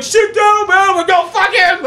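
high, loud mock heckler yell; angry crowd heckling. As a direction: holler like a mob turning on someone. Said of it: "And people are like".

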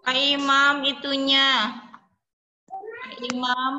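calling out. Real, not acted. Calling to Pak Imam online.

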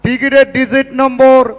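normal talking voice

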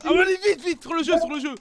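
excited urging. Real, not acted. Urging friend to hurry in game.

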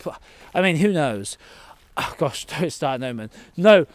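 ordinary delivery

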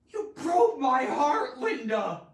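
loud, high, drawn-out anguished yell; sad, angry frustration. Shown as a demonstration. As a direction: wail it in hurt and fury. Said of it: "sadness, anger, frustration. Just".

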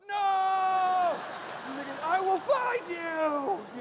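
shouting a revenge vow after the car that hit his wife; vengeful shout. Retold or imitated. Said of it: "I'm like".

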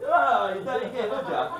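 loud, high, slow teasing jeer; mocking taunt. Friend teasing mates who misheard, loud high jeer. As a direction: rub it in like a playground taunt. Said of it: "You go".

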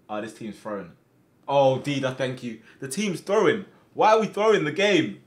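usual speaking voice

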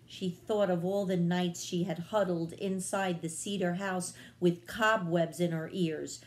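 ordinary delivery

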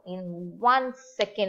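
ordinary talk

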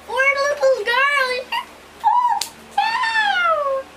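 high, slow sing-song pity; high drawn-out mock sympathy. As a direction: coo it in a high, stretched voice. Mock pity for falling character.

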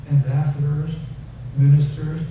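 ordinary talk, nothing marked

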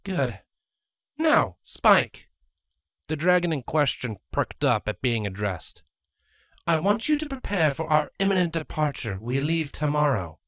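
lightly voiced queen, strained smile; Queen Chrysalis. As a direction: greet them, hiding anger.

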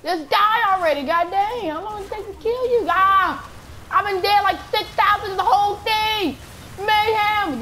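very high, loud sustained ranting; exasperated screaming. Real, not acted. Gamer begging a boss to die already, exasperated high screaming.

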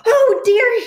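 very high, loud frightened cry; mock alarm. Snake sees the Gruffalo, cries out in mock fright. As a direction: gasp in hammed-up panic.

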